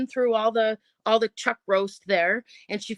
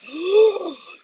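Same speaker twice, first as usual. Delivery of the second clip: gasp; 6 semitones higher, 5 dB louder than usual. Retold or imitated. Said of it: "She goes".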